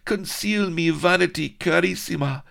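high, breathless damsel pleading; high pleading heroine. Acted. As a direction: beg to be hidden, pitched high.

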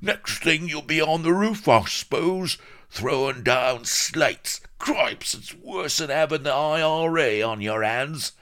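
high, loud outraged rant; swearing jailer. A narrator's reading. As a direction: scold furiously at high pitch. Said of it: "swore at the sight".

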